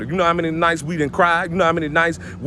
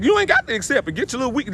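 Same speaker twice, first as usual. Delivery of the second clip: pitched way up in mock scorn; mocking high voice; 8 semitones higher, faster than usual. Mocking people who refuse the truth, high scornful voice. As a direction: sneer it up high, mocking the listener. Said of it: "I'm like".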